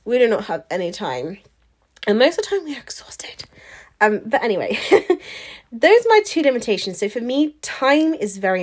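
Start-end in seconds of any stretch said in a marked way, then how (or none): 2.1-3.4 whispered tired confession; whispering. Real, not acted. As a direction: drop to a breathy whisper. Tired mum aside, under breath.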